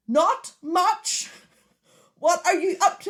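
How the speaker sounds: loud, high, spaced-out dictation; shouted voice command. Retold or imitated. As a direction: shout each word so the car understands. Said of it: "times of me shouting".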